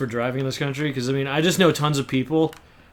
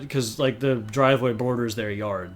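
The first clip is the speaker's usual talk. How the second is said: usual speaking voice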